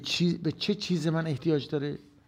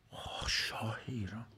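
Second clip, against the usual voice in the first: whisper; 9 dB quieter than usual. Real, not acted. Sighing whispered aside.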